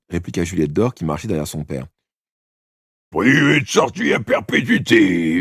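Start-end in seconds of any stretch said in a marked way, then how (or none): 3.1-5.3 loud, slow, high yell. A narrator's reading. Father bursting into his son's room, yelling slowly and loudly. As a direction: bellow the punishment at him. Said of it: "hurla monsieur Hidalphe".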